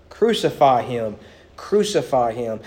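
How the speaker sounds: normal talking voice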